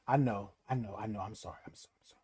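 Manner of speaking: soft whispered repeated apology; whispered sorry. Real, not acted. Apologizing to viewers after insulting a hater, whispered. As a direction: whisper it sheepishly. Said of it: "whispers apology".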